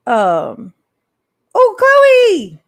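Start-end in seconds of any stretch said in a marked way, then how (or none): 1.5-2.6 high excited greeting. Real, not acted. Greeting a chat viewer.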